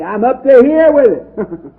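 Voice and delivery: high, loud exasperated voice, slowly; everyday exasperation. Retold or imitated. Preacher voicing a fed-up listener, loud and exasperated. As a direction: act out a fed-up modern man, stretched and emphatic. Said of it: "We would say".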